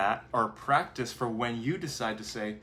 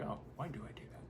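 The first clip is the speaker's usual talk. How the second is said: whispered thought; 2 semitones higher, 15 dB quieter, faster than usual. Acted. Quoted self-questioning thought.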